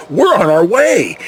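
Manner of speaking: ordinary delivery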